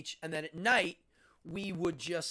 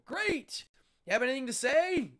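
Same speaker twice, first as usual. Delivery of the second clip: emcee; 7 semitones higher, 5 dB louder than usual. Retold or imitated. Host interviewing kids onstage.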